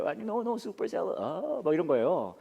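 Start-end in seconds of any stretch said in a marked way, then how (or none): 0.1-1.2 higher, flustered no no at the counter; a lightly voiced flustered customer correcting the order. Comedian replaying himself flustered ordering salad in English. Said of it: "식당을 갔는데".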